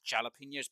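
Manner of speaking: jalapenos with a spoken English J as in jam; sounded J. Shown as a demonstration. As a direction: start jalapenos on a clear J sound. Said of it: "because the J is not silent".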